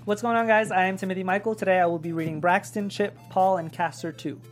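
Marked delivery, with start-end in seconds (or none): none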